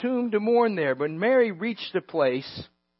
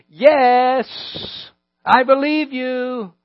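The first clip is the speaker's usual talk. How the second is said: slow, louder, higher mock yes; mock emphatic faith; 5 semitones higher, 6 dB louder, much slower than usual. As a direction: ham up her eager belief. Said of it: "And she said".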